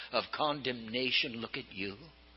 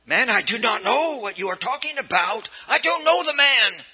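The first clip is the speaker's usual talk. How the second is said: panicked denial; 6 semitones higher than usual. Acted. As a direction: deny it in a frightened rush. Peter's frightened denial.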